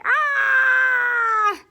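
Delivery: high, loud playful shriek; mock scream. Reading to children, screaming at the shark. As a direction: pretend terrified yelp.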